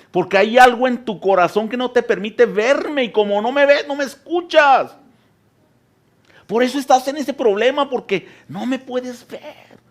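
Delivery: high, loud preaching cry as God; God pleading from the storm, loud and high; God shouting. Acted. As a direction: call out as God from the whirlwind. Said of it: "y quizá Dios te dice".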